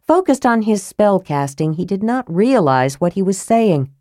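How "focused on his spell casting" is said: ordinary delivery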